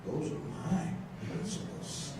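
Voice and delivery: whispered, awed; hushed realization. Retold or imitated. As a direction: breathe it out softly, as if in awe. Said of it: "And I said".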